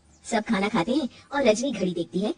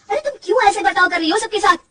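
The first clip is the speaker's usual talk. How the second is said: much higher, louder, fast reproach; husband demanding an explanation from his wife; angry confrontation; 10 semitones higher, 7 dB louder, faster than usual. Acted. (गुस्से में तेज़ फटकार)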